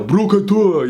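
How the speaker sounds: high mock yell, drawn out; playful imitation of a called-out nickname; imitated yell. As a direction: mimic a teammate yelling a nickname. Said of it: "he would yell".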